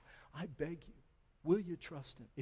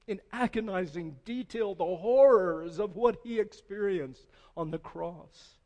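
loud, rising sermon climax; preaching at full force; 5 semitones higher, 11 dB louder than usual. Acted.

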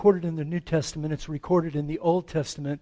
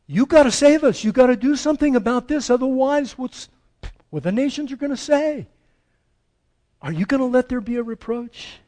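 higher and louder, pressing God hard; urgent demand; 6 semitones higher, 5 dB louder, faster than usual. Retold or imitated. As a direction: insist loudly, almost scolding heaven. Prophets demanding God act.

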